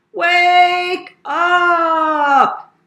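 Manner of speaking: long, high, sung-out holler; loud yell. Acted. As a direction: yell it high, stretching each word. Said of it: "yelled at Amy as loud as she could".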